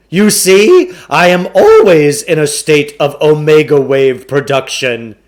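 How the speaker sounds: a slick con man pitching the deal; booming carnival barker sell; pushy salesman. A narrator's reading. Klugarsh boasts.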